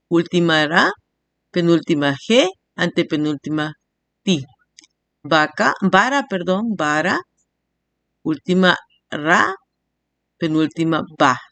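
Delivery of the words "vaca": usual speaking voice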